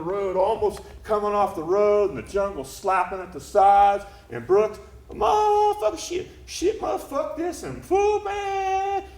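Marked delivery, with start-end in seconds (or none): 5.1-9.0 very high, strained squeal of swearing; squeaky, high-pitched sputtering; frantic cursing driver. Acted. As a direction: curse in panic while wrestling the wheel. Acts driver cursing at the wheel.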